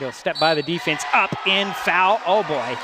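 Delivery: building to a loud, high shout; excited play call. Real, not acted. Announcer calling a drive to the basket and foul, excited. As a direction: punch it out as the foul comes.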